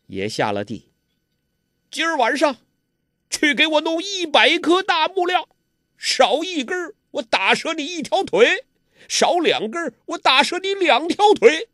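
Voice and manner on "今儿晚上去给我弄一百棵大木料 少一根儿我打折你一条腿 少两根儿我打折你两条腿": arrogant villain. A narrator's reading. Ouyang Feng ordering Huang Rong to tend his nephew, high bullying voice. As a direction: boss her around like a servant. Villain threatens Guo Jing.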